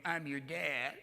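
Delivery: mock fey little writer voice; Truman Capote. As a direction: impersonate a soft, fussy author, drawn out. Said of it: "It wasn't Truman Capote going".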